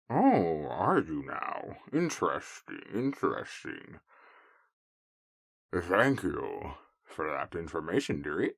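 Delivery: hushed, slow scheming wolf; a wolf purring over useful news; quietly sly wolf voice. Acted.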